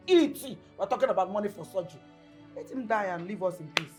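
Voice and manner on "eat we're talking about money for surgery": fast, high and loud ranting; angry tirade. Acted. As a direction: rattle off the grievance in outrage. Mother rants about surgery money.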